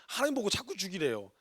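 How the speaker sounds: normal talking voice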